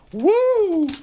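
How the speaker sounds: high-pitched "woo"; sudden high hoot; exasperated whoop. Acted. Exasperated whoop mid-quarrel.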